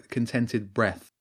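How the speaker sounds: ordinary delivery, nothing marked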